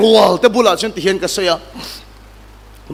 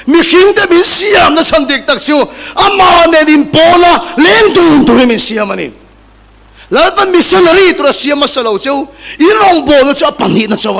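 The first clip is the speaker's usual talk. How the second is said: loud, high-pitched sermon delivery; impassioned pulpit cry; full-force preaching; 4 semitones higher, 4 dB louder than usual. Acted. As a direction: proclaim it to the whole congregation, key words shouted.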